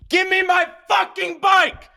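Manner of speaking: high furious scream at the thief; desperate shrieking yell to get the bike back. Retold or imitated. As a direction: scream it at the top of your lungs. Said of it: "Like, screaming".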